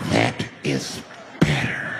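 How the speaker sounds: deep, drawn-out acted satisfaction; low menacing approval. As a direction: drop it low and let it linger. Repeats it to the quieted crowd.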